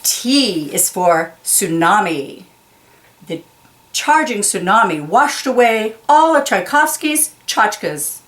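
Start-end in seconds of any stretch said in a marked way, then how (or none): none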